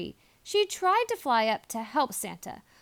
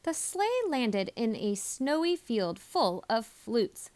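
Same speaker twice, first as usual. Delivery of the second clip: plain reading voice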